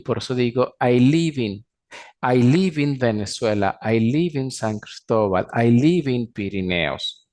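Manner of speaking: "live in" linked as one sound, "libin"; "I live in" said as "ai libin", consonant joined to the vowel. Shown as a demonstration. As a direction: run "live" straight into "in", no break. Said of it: "Esa sí la puedo unir con in".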